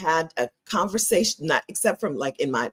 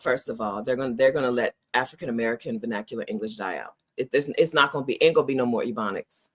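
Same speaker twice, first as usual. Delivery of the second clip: normal talking voice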